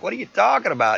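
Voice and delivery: high, loud imitation of an indignant girlfriend; indignant protest. Girlfriend answers her accuser on video chat, indignant protest.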